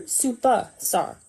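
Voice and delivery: Superstar drawn out slowly; the right version, slow and deliberate; correct take. Shown as a demonstration. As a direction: stretch Superstar, every syllable. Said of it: "Not, not super".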